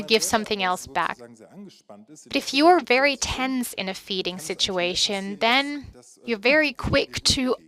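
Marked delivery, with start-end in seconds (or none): none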